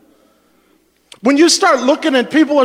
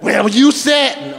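slow, hammed-up accusation "you said"; mock accusing spouse; slower than usual. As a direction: sulk as the wronged spouse, slowly. Angry spouse acted.

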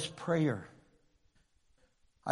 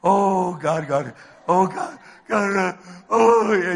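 loud, repeated panicky oh Gods; hammed-up anxious pleading; mock freaking out; 7 dB louder than usual. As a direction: play a nervous desperate prayer. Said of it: "You're freaking out".

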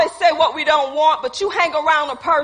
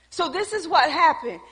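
usual speaking voice

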